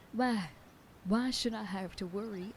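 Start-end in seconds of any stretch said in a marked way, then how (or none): none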